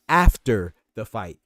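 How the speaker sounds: AFTER drawn out slowly and very loud; stress on AFTER. Shown as a demonstration. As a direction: boom the word after, stretched for emphasis. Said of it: "Emphasis, once again".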